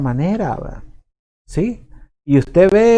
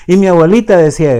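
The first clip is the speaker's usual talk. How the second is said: louder and faster, repeating with emphasis; insistent grandchild; 4 semitones higher, 8 dB louder, faster than usual. Retold or imitated. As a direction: hammer home the repeated phrase like an eager grandchild. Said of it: "muchos nietos siguen diciendo".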